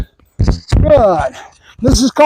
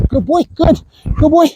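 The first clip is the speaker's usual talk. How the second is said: excited dog praise; 6 semitones higher than usual. Real, not acted. Praising dog after release.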